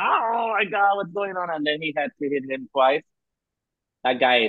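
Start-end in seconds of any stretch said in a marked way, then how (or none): 0.0-1.5 high, wailing replay of shock; mock alarm. Co-host reliving her reaction to the table hit, mock alarm.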